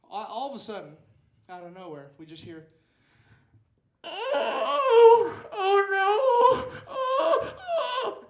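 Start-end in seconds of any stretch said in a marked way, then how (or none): 4.0-8.2 shrill panicked wailing, very high and loud; grandma screaming. Retold or imitated. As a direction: wail like a frightened old woman. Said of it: "she's just screaming like her house was on fire".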